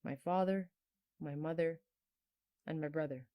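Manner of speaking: normal talking voice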